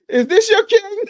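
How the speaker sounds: loud, very high-pitched movie-villain shout; high, booming mock-villain yell; Killmonger. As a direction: shout it out like a challenge to a crowd. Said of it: "what did Killmonger say?".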